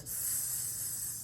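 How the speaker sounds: slow unvoiced snake hiss. Shown as a demonstration. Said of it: "S makes the sound of a snake".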